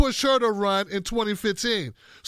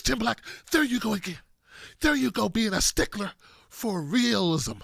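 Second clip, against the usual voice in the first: ordinary delivery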